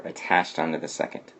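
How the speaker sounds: ordinary delivery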